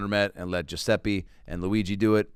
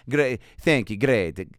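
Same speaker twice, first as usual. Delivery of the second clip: mock Italian accent, quick and choppy; 3 semitones higher than usual. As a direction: put on a comic Italian accent. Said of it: "in an Italian accent".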